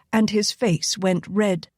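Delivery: ordinary delivery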